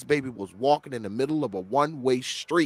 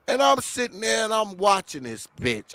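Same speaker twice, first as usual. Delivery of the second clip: normal talking voice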